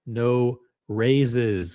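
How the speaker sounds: ordinary talk, nothing marked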